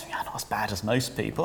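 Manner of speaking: unvoiced whisper, confiding; whispered aside. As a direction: whisper it smugly. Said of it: "they'll often say something like".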